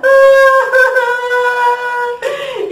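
high, loud sing-song fake sob; mock wail. Number six starting to cry, loud high fake sob. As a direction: wail out like a cartoon crybaby. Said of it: "el seis comenzó a llorar".

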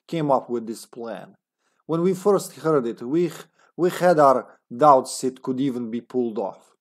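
put-on comrade Russian accent. Agent's journal about a final plan, Russian accent. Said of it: "a comrade accent".

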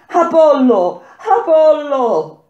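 high, loud cry to a god; desperate calling out at the top of the voice; full-force shout. A narrator's reading. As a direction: scream the name as an invocation. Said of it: "gridai più forte che potei".

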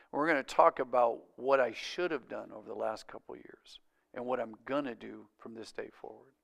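repentant husband. Retold or imitated.

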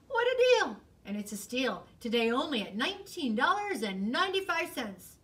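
hammed-up infomercial host; pitchman. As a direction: sell it like a TV ad. Fox as TV salesman.

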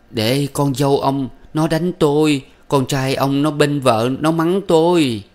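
keen to the dead husband; wailing lament. A narrator's reading. Old widow wailing to her dead husband, sobbing loudly. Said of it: "nức nở gào".

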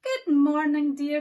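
loud bright good morning; loud cheerful wife; cheery Missus Bear. Acted. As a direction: greet him sweetly. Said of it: "she said".

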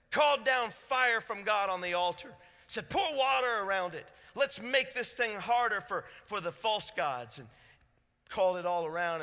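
loud, high preaching about the altar; fiery preaching. Acted. Preacher voices Elijah mocking the false gods, loud and high. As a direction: ring it out over the congregation.

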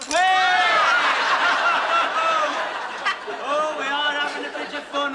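loud high hey breaking into laughter; laughing whoop. Real, not acted. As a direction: whoop with delight, then laugh. Being urged to dunk.

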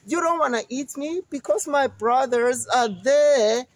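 coaxing the monster to wait; goat voice. Acted.

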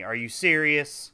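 ordinary talk

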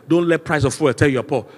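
ordinary delivery

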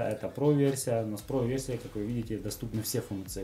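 usual speaking voice

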